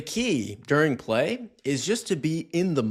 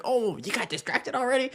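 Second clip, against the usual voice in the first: much higher, teasing voice to a dog; playful dog talk; 11 semitones higher than usual. Retold or imitated. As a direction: tease the dog in a squeaky high voice. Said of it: "joking about it".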